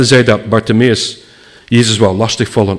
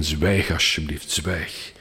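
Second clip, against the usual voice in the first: hushed, falling "zwijg", nearly breathed; 5 semitones lower, 9 dB quieter, slower than usual. Retold or imitated. Crowd hushes the blind beggar, quiet shushing. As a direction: shush him softly.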